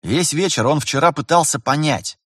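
usual speaking voice

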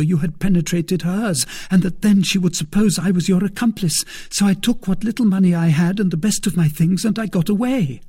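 put-on high, loud, agitated young maid; distressed maid. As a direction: pour out the frightening story, high and urgent. Voices Kitty, frightened maid, telling her escape.

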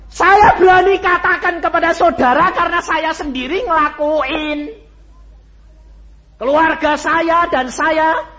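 loud, high testimony cry; preaching at full force. Acted. Preacher proclaiming his own practice to the congregation, full force.

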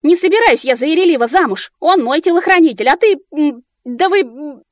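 высокая громкая возмущённая тирада; заменяй ругательства сердитым мычанием; злясь, мыча вместо брани. A narrator's reading. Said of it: "только успевала мычать в нужных местах". (возмущённо, с мычанием)

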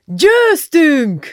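high, loud victory cry; triumphant shout. A narrator's reading. Dénes shouts out of the open window, triumphant shout. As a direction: shout it out to the whole world. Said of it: "világgá kiáltotta".

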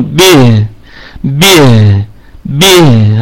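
به with a clean stop on the ha; clipped still ha in به, said three times; sukun demo. Shown as a demonstration. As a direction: stop dead on the ha in به. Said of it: "هكذا".

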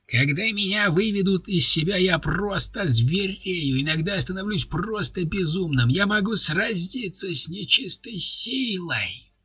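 a big man bragging in a squeaky high voice; high ranting. A narrator's reading. Ryzhy ranting, voice risen high.

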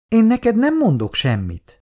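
put-on high-pitched defiant boy; defiant child. A narrator's reading. Son refuses to answer father, high defiant child.

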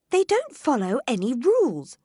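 Alice complaining about the croquet. Acted. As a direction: grumble to the Cat about the game. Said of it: "she complained".

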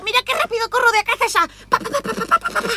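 put-on high, fast, breathless little kid showing off; excited kid voice. Kid shouting to mom while running, high and fast. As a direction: squeal like a kid racing across the yard.

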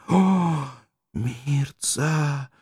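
a mild lift on "О"; last burst of joy; exclaiming. Acted. Dying Arto exclaims her name, mildly. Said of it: "воскликнул он".

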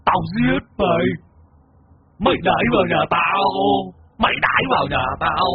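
possessed roar. A narrator's reading. Spirit in the disciple threatens to kill, possessed wail. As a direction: stretch each word in a high possessed cry. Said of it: "mồm gầm lên".

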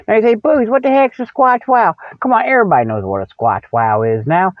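normal talking voice

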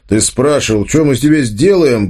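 growing threat, pitch climbing at the end; muffled angry rumble. A narrator's reading. Tolik answers Arsen after striking him, rumbling menace. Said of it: "глухо пророкотал Толик".

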